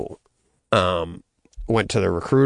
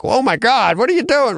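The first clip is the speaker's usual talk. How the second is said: much higher, startled mom's cry; mock alarmed mom; 4 dB louder than usual. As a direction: play the shocked mother, pitched way up. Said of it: "you'd think would be like".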